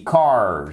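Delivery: "cars" ending on a buzzing z; low, falling "cars" with a z ending; final Z sound. Shown as a demonstration. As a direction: say "cars" with a voiced, held z at the end. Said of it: "Z ici".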